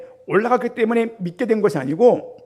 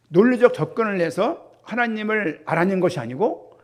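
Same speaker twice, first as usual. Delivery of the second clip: normal talking voice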